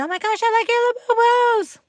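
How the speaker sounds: very high, squeaky gushing; excited little kid. Retold or imitated. Little kids come up to admire Labubus, squealing excitedly. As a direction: imitate an excited child. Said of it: "little kids that come to me".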